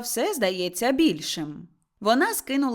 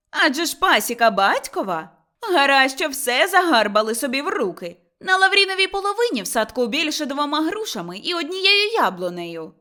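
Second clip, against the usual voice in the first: raised, accusing voice; indignant complaint; 5 semitones higher, 6 dB louder than usual. Acted. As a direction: accuse them of grabbing everything. Motria complains about inheritance. (обурена скарга)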